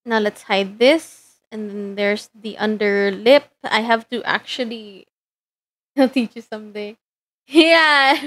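ordinary delivery

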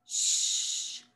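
put-on soft hushed sh, like over a sleeping baby; whispering over a crib. Shown as a demonstration. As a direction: breathe out "Shh" quietly, no voice. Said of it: "Don't wake the baby".

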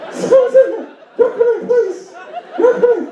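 very high, stressed cartoon falsetto; Mickey Mouse. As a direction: squeak it out in a panicked mouse voice. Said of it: "I start sounding like Mickey Mouse".

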